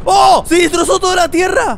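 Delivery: very high, loud shout of shock; amazed shout. Real, not acted. Gamer watching the Earth get shattered, amazed shouting. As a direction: burst out shouting at the top of your range. Sees the Earth suddenly blown apart.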